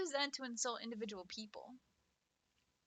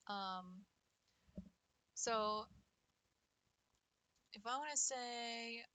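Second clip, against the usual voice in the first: normal talking voice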